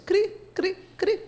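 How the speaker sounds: high, spaced-out cricket chirps; cricket chirping. Acted. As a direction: imitate crickets in an awkward silence. Imitates crickets filling a silence. (cri-cri agudo de grilo)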